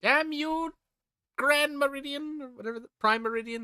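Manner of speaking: high, loud theatrical shaking of fist; mock dramatic curse. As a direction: curse the meridian like a villain's victim. Mock curse at time zones.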